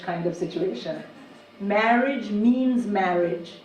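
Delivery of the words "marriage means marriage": slow. Acted. Punchline slow, Brexit parody.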